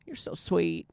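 put-on light Southern accent, sweet; a cute little Southern accent; mild Southern accent imitation. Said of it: "the cutest little Southern accent".

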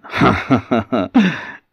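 taunting ghostly laugh; mocking laugh. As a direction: laugh him off with mock scorn. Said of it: "kinda looked at that and went".

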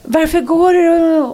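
loud, high, drawn-out plea; mock wail. Friend's wife to husband leaving for the woods, mock wailing. Imitates abandoned woman as man leaves.

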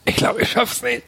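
hoch und gespielt atemlos; keuchend, als ginge die Luft aus; außer Atem. Pretend. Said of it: "Ich krieg jetzt schon ganz schlecht Luft". (gespielt nach Luft ringend)

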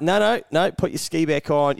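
high, loud call of no, no, no. Retold or imitated. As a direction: instructors hollering from the shore, loud and high. Instructors calling him back out.

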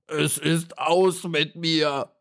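slow, high, hammed-up despair; a beetle on its back wailing its doom; wailing cry. A narrator's reading. The beetle lying helpless on his back cries out, high and slow. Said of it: "schrie er".

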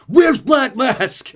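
slow, loud, high Batman roar; hammed-up shouted demand. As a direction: bellow it as an over-the-top hero. Acted Batman demanding an answer.

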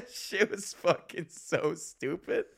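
slow words through helpless laughter; can barely get it out for laughing. Real, not acted. Vlogger cracking up at his own wedding blunder, real laughter.

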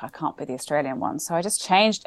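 ordinary talk

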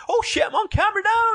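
very high, shouted streamer yelp; excitable streamer. Retold or imitated. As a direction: squeal it like a hyped streamer caught on camera. Excitable hacker streamer voiced.

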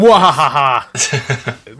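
slow theatrical villain cackle; mwahaha, slow and menacing; evil laugh. Pretend. Host playing villain.

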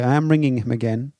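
usual speaking voice

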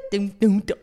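ordinary delivery

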